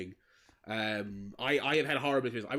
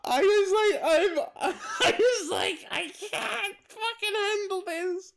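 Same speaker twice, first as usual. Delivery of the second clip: much higher and louder, laughing through it; real laughter while recalling the cinema; 6 dB louder, much slower than usual. Real, not acted. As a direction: collapse into laughter on the words. Cracking up at the memory.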